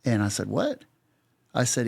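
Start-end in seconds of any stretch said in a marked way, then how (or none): 0.5-0.8 high, rising one-word question; incredulous. Retold or imitated. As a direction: act out a disbelieving "what?". Retells his startled reaction to offer.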